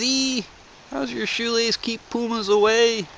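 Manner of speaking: high, puzzled customer voice. Retold or imitated. Customer asking the clerk, high and puzzled. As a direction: squeak it out like the baffled customer.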